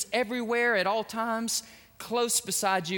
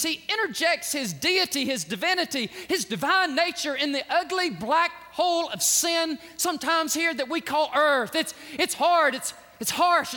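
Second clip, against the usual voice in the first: rising, louder preacher's build; preaching at full force, higher and louder; 4 semitones higher, 3 dB louder than usual. Acted. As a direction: drive it home like a revival preacher.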